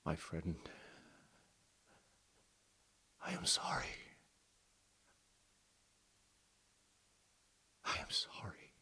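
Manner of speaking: faint, whispered apology; weak voice. Acted. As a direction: barely breathe the words out. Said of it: "with a weak voice he says".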